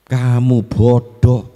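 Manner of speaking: loud slow scorn; scolding parent. Retold or imitated. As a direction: drag out "Kamu bodoh" loudly like a parent berating a child. Parent belittling a child.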